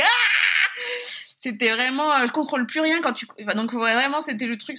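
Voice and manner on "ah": short high cry of pain; imitated pain cry. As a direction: yelp it high as in labour. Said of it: "je criais". (petit cri de douleur imité)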